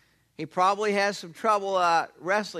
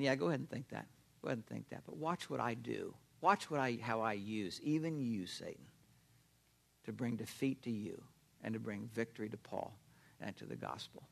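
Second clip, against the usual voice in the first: quiet confident God voice; 9 semitones lower, 12 dB quieter than usual. Retold or imitated.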